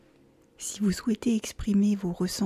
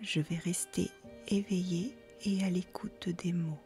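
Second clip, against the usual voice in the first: soft mantra; 7 dB quieter than usual. Shown as a demonstration. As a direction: let it sink inward, quietly. Mantra repeated as softer echo. (mantra répété doucement)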